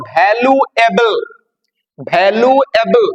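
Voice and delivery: ভ্যালুয়েবল drawn out slowly, broken into its syllables. Shown as a demonstration. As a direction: break ভ্যালুয়েবল into slow, separate syllables. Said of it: "উচ্চারণ করতে গেলে সিলেবেলে যখন ভাঙছে".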